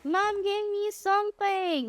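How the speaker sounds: high, drawn-out delight; excited. Real, not acted. Streamer getting a present from Mom, high excited drawl. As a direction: stretch it out high and slow, thrilled. Squealing over a gift in game.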